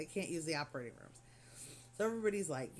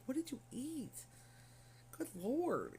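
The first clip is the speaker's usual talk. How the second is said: incredulous; 8 semitones higher, 3 dB quieter, slower than usual. Retold or imitated. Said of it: "I'm like".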